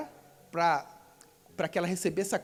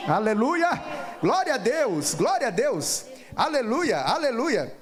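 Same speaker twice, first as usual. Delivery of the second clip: loud, ringing exclamations in repeated waves; exultant praise; 2 semitones higher, 4 dB louder, slower than usual. Real, not acted. Preacher leading the congregation in hallelujahs, exultant praise. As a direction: call out each praise with force. Praise after the sermon's climax.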